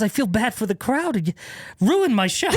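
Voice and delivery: high, indignant imitation; comically wounded friend; mock accusation. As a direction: accuse in high-pitched exasperation.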